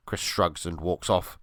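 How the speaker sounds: ordinary delivery, nothing marked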